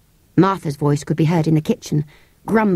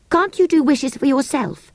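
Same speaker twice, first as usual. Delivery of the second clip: put-on puzzled girl; 9 semitones higher than usual. Girl questions creature.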